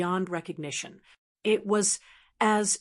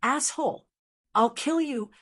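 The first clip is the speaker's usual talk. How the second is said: angry outburst; 4 semitones higher than usual. A narrator's reading. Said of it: "Tang Tian glared angrily".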